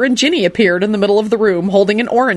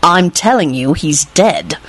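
understated stubborn insistence; slower than usual. A narrator's reading. Lupin insists the man is dead, stubbornly. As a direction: insist without budging. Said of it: "Lupin replied stubbornly".

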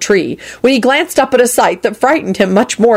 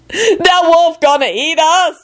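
high, slow terrified wail; 10 semitones higher, slower than usual. A narrator's reading. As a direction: draw it out in high panic. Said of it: "Lucretia wailed".

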